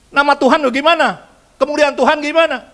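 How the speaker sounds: high, loud scolding questions; loud scolding angel; angry rebuke. Acted. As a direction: demand it like a furious angel.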